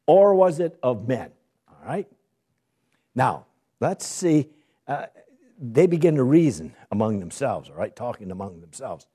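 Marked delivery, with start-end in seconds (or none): none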